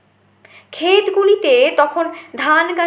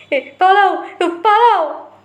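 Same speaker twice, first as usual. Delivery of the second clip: louder, higher cry to flee; frantic running shout of run, run; 5 semitones higher, 3 dB louder, slower than usual. Acted. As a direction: yell it while running through the village. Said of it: "বলে চিৎকার করতে করতে".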